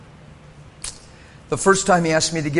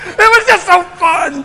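much higher, louder, slower, near tears; overjoyed; 12 semitones higher, 9 dB louder than usual. Real, not acted. Preacher overcome with joy at the memory, higher and louder. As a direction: gush with real joy.

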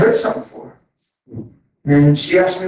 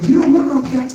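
worried attendant; 12 semitones higher than usual. Retold or imitated.

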